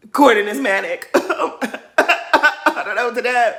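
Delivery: slow, laughing, lightly voiced mock despair; mock Mia distress. Mimicking Mia's dramatic plea to the group, slow mock despair. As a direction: drag it out like a put-on breakdown. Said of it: "she was like".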